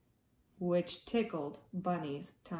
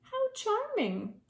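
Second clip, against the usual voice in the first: much higher, charmed little cry; delighted lynx; 13 semitones higher than usual. Acted. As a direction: gush over it like a delighted lynx. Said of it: "she thought".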